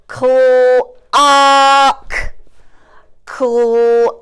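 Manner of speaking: clock stretched long and even on the vowel; slow, drawn-out clock; roller coaster word. Shown as a demonstration. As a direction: hold the ah in clock like a hill top. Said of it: "do your roller coaster".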